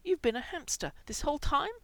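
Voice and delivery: high, urgent rush of alarm; desperate. A narrator's reading. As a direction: pitch it up and let the panic build. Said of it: "Mikey said desperately".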